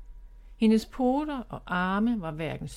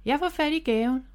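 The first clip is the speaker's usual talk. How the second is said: higher, eager call across; 6 semitones higher than usual. Acted. Miffy calls to Bob she'll get the gift, eager call. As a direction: shout it to her brother. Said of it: "råbte Miffy til Bob".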